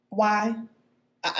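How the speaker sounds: ordinary talk, nothing marked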